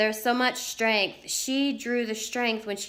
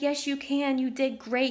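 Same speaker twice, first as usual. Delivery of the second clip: encouraging; 5 semitones higher than usual. Retold or imitated. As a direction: lift her up gently. Mother encouraging crying daughter.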